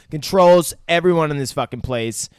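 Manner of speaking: usual speaking voice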